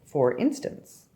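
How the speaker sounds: usual speaking voice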